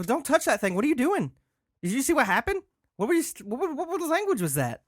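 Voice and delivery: high, loud frantic questions; shrill panic, firing questions; alarmed shouting. Acted. Confronts friend after strange trance.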